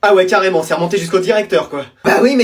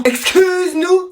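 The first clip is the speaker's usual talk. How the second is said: slow indignant excuse-nous; 8 semitones higher, much slower than usual. Acted. Sibling outraged at unfair gift.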